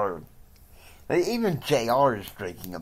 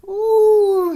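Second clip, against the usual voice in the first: excited ooh; 11 dB louder than usual. Acted. Sudden excited exclamation.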